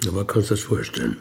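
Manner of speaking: usual speaking voice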